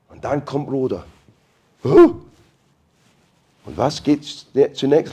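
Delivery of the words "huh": surprised huh. Pretend. Rhoda hears knocking.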